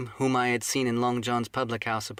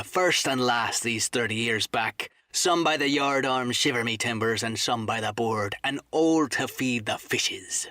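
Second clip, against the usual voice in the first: menacing sea cook. Acted. Silver putting Morgan in his place, menacing seaman's voice. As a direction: warn him off like a dangerous old pirate.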